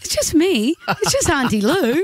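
high-pitched coaxing auntie voice; reassuring a baby. Retold or imitated. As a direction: coo nervously to a crying baby. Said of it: "and I'm just going".

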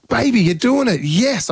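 high-pitched cheering, acted out; proud dad. Dad cheers on climbing daughter, proud encouragement. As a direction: re-enact a dad thrilled for his kid. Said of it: "I was a proud dad".

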